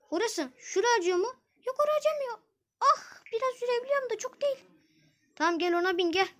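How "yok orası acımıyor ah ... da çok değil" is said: put-on high girl voice. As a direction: answer lightly as the girl. Zeynep answers about her leg.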